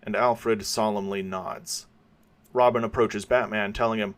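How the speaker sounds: usual speaking voice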